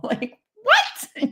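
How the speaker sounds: very high laughing disbelief; incredulous what. Real, not acted. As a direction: squeal it in disbelief. Laughing at own absurd plan.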